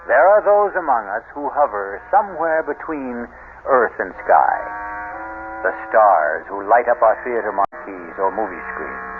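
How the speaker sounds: steady announcer voice for credits and sponsors; host announcer read. A narrator's reading. Radio host introducing the drama and sponsors, announcer style. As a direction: present the show like a radio host.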